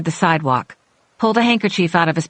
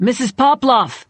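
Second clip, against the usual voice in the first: raised, higher call down the street; 6 semitones higher than usual. A narrator's reading. Boyish man calls to fleeing woman from down the sidewalk, calling out. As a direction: call after someone from a distance. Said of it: "the boyish man called from down the sidewalk".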